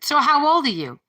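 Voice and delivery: high, loud imitating a relative asking her age; a relative blurting the question out loud; mock loud question. Relative asks her age, loud and high. Quoting family member's question.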